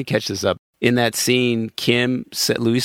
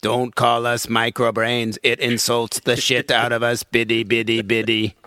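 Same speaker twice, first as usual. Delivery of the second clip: lightly voiced alien; 7 semitones lower than usual.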